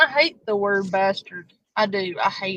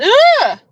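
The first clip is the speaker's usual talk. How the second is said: loud, high-pitched "ah!"; sudden high yelp; 12 dB louder than usual. Real, not acted. Streamer searching for something, sudden yelp. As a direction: yelp sharply, far above your usual voice.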